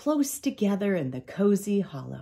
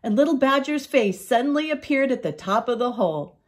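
usual speaking voice

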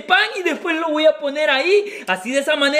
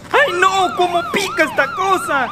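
high, strained squeal from the spice; burning mouth; 6 semitones higher than usual. Acted. Boyfriend secretly suffering from hot chili, burning-mouth squeal.

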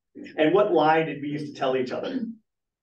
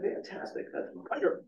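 whispered delight; 11 dB quieter than usual. Retold or imitated. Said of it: "I was like".

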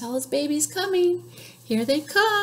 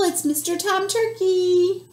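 usual speaking voice

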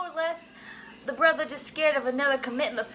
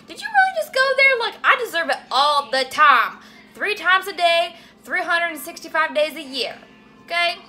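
louder, offended comeback; mock indignant retort; 7 dB louder than usual. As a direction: fire back at the husband, loud and affronted. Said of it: "And I'm like".

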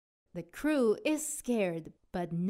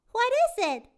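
high, louder first mate voice; Callie asking; 13 semitones higher, 6 dB louder than usual. Acted. First mate Callie asks the captain, high voice. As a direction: ask it in a bright high voice. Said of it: "asks First Mate Callie".

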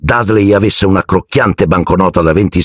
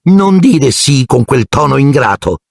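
high, scolding aunt snarl; high mock growl; Aunt Marge growling; 7 semitones higher than usual. A narrator's reading. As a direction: scold the ungrateful boy, pitched high. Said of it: "ringhiò zia Marge".

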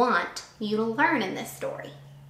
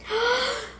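gasp; 14 semitones higher than usual. Pretend. Reader reacts to story.

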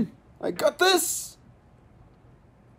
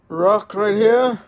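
ordinary talk

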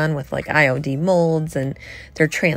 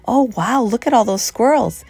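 delighted gush; 6 semitones higher, 3 dB louder than usual. Retold or imitated. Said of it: "and she's like".

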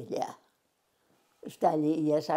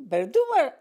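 high, louder surprised question; disbelieving you'd really go; 9 semitones higher, 5 dB louder, faster than usual. Retold or imitated. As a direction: friend asking in surprise. Friend's surprised question retold.

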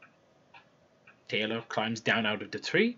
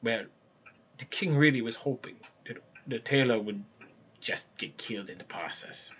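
usual speaking voice

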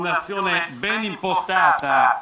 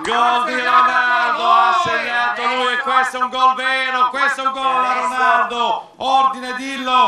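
goal celebration shout; 3 semitones higher than usual. Real, not acted.

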